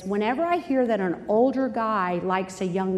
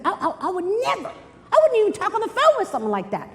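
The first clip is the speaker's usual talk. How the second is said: high-pitched, lightly mocked girl indignation; indignant girl; 9 semitones higher, faster than usual. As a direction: play a girl protesting, pitched up. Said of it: "some of the girls in this room, they're like".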